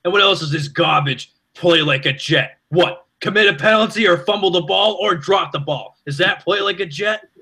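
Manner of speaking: high, mocking New York radio rant; Mike Francesa rant impression. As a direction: play the outraged talk-show host in a big New York accent. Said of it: "he goes".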